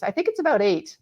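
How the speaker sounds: imitating a husband's dry deadpan reply. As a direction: deliver the punchline matter-of-factly. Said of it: "my husband literally deadpan".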